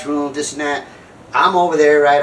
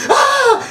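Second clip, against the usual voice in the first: very high, loud wail; hammed-up scream of distress; mock scream; 5 dB louder than usual. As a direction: burst in with a shrieking "ah". Her bursting in, acted.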